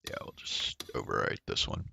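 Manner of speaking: quick, hushed aside off the mic; whispered aside. Real, not acted. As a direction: whisper a note to yourself, fast. Off-mic note about recording.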